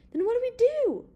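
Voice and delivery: very high, loud, urgent plea; high-pitched panicky demand; frantic question. A narrator's reading. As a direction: ask in alarm, voice leaping up. Bigman frantic with gun on them.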